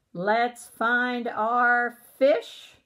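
very slow "Let's find our fish." as if hunting; drawn-out searching. Shown as a demonstration. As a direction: stretch it out while looking. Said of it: "searching slowly on screen".

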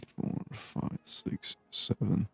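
ordinary delivery

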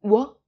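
"wo" of water punched loud; stressed first syllable "wo". Shown as a demonstration. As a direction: hit "wo" hard, leave the rest light. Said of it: "the stress is on the first syllable".

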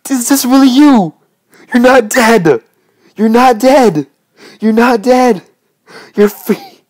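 high, loud joyful cries; real excitement at a reunion; squealing with real delight; overjoyed disbelief. Real, not acted. Reunited with lost horse.

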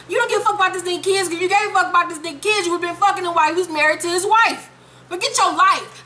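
high-pitched, rapid scolding; exasperated rant. Real, not acted. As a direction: tear into her in a fast, high rush. Ranting at character's hypocrisy.

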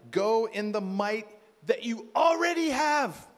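booming command; loud divine command. Acted. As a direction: declare it like God's order. God's command voiced.

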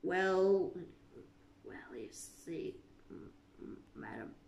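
whispered, low, stammering fear; frightened stammer. A narrator's reading. Gardener answers the Queen, terrified whisper. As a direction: stammer in terror.

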